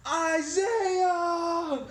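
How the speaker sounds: high, loud desperate yell; shouting brother's name. Retold or imitated. Boy getting whipped, yelling his brother's name for help. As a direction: scream for your brother to help.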